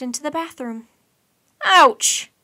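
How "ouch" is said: high, loud ouch; squeal. A narrator's reading. Mike trips on a rubber ducky, loud squeal. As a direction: yelp as you trip. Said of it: "the green monster squealed".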